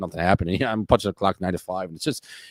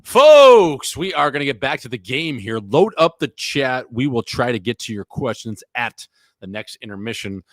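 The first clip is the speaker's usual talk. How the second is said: booming TV host opener, much louder and higher; announcer voice; 4 semitones higher, 6 dB louder than usual. Acted. Hockey host addressing viewers, booming announcer voice. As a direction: play the broadcast host back from break.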